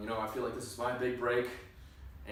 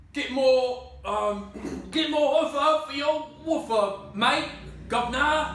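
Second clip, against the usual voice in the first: exaggerated British accent, higher and louder; 9 semitones higher, 6 dB louder, slower than usual. Actor hams up British accent for the director. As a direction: ham up a cartoon British accent. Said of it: "offensively British".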